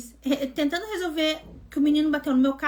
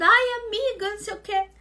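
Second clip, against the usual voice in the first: mocking whine; 7 semitones higher, 3 dB louder than usual. Mocking the boy's excuse.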